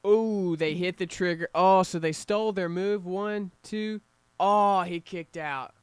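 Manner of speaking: excited call. Real, not acted. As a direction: cheer the near fall live. Live near-fall excitement.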